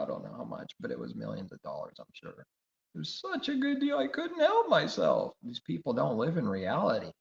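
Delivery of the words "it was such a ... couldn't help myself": high, loud whiny voice of a spoiled rich man; mocking rich preacher. Preacher mocking a rich man who bought a jet, high whiny squeal. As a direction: squeal out the excuse like a pampered tycoon.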